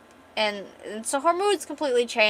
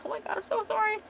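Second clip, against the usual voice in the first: embarrassed squeal; 12 semitones higher, 3 dB quieter, faster than usual. Retold or imitated.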